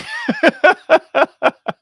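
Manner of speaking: high-pitched giggle at own joke; high laugh, cracking up. Real, not acted.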